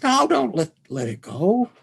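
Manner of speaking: very high, loud cry of refusal; farmer yelling. Retold or imitated. Farmer refuses the voice the next day, yelling. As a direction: yell it high as the panicked farmer. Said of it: "the farmer says".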